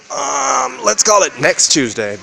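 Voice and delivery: loud and slow, drawn out; loud slow taunt to viewers; shouted sign-off. Real, not acted. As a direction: yell it at the camera. Ranting sign-off.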